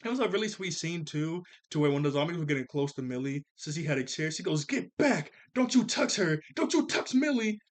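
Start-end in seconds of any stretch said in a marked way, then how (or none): 4.7-7.6 high, loud imitation of a fierce warning; protective mock shout. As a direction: act out warding off attackers from a friend. Quotes Sissy defending Millie with chair.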